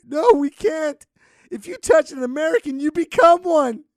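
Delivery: very high, loud fake-crying warning; mock anguished wail. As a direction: cry out as if doomed. Pretend refugee warning, begging rescue.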